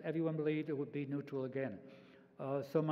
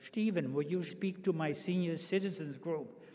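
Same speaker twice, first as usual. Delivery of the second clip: imitating a mother's heavy German accent; lightly acted German-accented request. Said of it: "with her accent, she said".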